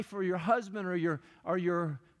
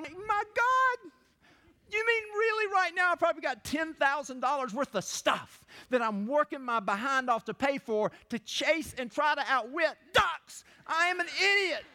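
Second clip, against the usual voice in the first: shocked realization; 6 semitones higher than usual. Retold or imitated. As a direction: shout out a sudden realization. Acts out own realization, shouting.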